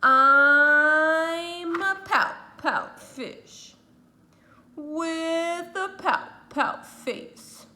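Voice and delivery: slow, drawn-out sad moan; gloomy fish refrain. Acted. The pout pout fish tells the sea his gloomy refrain, slow and sad. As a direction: moan it out slowly like a sulky fish.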